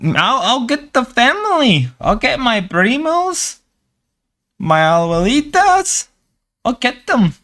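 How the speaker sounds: put-on high, playfully menacing Mexican accent; mock Mexican accent. Relative threatening to fetch the family, in a mock Mexican accent. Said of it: "I gotta stop doing the Mexican voice".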